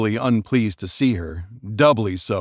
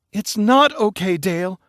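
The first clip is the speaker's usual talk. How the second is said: much higher, whiny protest; upset protest; 10 semitones higher than usual. Acted. Bride protesting to her fiancé, upset. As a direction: refuse to be soothed.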